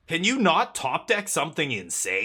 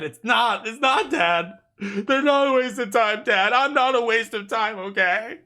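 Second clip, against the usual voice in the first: mock pleading with dad; 9 semitones higher, 8 dB louder than usual. Arguing with dad that games matter.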